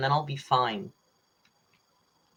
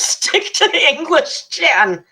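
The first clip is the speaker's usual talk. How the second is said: mock scolding; 12 semitones higher, 10 dB louder, slower than usual. Real, not acted. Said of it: "Jan says".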